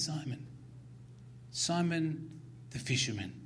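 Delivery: soft, tender calling of a name; gently. Retold or imitated. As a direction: say it softly, like a quiet call. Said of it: "restores Peter simply and gently".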